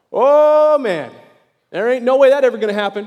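loud, high groan of dismay; exasperated crowd. Retold or imitated. The people at Moses' laws, loud high dismay. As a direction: throw up your hands at impossible rules.

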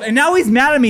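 ordinary delivery

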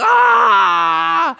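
loud, high, drawn-out pretend scream; mock scream of fright. Dad plays along with daughter's boo, exaggerated mock scream. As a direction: scream as if a toddler scared you. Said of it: "I'm like".

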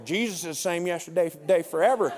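mock Southern complainer; grumpy Southern guy. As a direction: object like a grumbling Southern churchgoer. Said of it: "I hear that voice".

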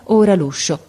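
usual speaking voice

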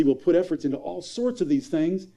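normal talking voice

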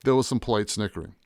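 ordinary delivery

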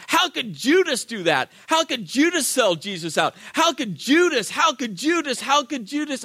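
high-pitched, repeated indignant outcry; acted rage. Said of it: "like, emotional rage".

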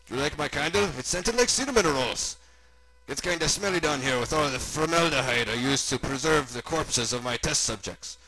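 casually creepy German host; German doctor character. Acted. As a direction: play a mad German scientist.

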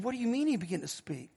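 very soft retold question; quiet, awed replay of the question; hushed disbelief. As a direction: ask it under your voice, stunned. Stunned father retelling his question.

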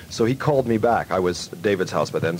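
normal talking voice

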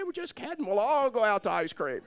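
high, sing-out reassurance; hammed-up high-pitched call; mock calling out. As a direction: act out waving down a car cheerily. Acting out calling to mom.

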